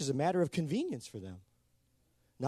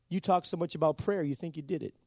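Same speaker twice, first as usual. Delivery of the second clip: ordinary talk, nothing marked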